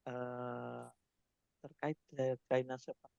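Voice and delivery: faint, distant voice on a weak mic; speaking too softly to be heard; barely audible. Real, not acted. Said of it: "suaranya terlalu kecil".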